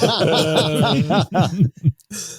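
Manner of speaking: squealing high laugh; high giggle. Real, not acted. Laughing after joke.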